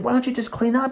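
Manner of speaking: high-pitched whiny complaint; mock exasperated self. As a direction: whine at the messy roommate. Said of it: "I said".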